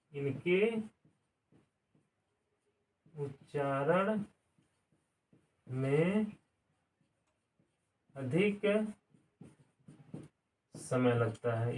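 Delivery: each word spaced out slowly with long pauses; quiet, slow word-by-word dictation. Shown as a demonstration. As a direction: spell it out slowly for students to write. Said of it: "teacher repeats slowly to dictate".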